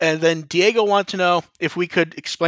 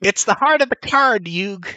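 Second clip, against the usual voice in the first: put-on New York accent; 4 semitones higher than usual. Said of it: "I can't do the New Yorker accent".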